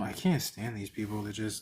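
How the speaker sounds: ordinary talk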